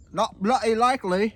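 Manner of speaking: loud, high mock Australian; exclaimed in an Australian-sounding accent; British-Australian accent. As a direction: belt it out in broad mock Australian. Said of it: "That feels Australian".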